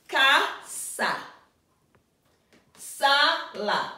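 "Casa, Sala, Mesa, Gato, Perro, Calle" split in two, first syllable lifted and punched, second falling; teacher's word drill, "Casa, Sala, Mesa, Gato, Perro, Calle" each strong then weak, pauses between; second-to-last syllable stressed. Shown as a demonstration. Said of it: "stress the second to the last syllable".

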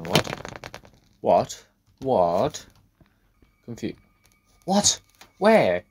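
loud, baffled outbursts; confused. Real, not acted. Player baffled by the game, loud confused yelps. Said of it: "That's actually confusing".

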